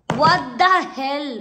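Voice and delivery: loud, slow, stretched what the hell; real shock at the mirror; shocked outburst. Real, not acted. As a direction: blurt it out in shock. Shocked at the haircut.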